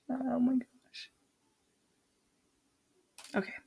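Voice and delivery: real whispered aside after a slip. Real, not acted. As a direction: whisper it under your breath. Exasperated aside after a flub.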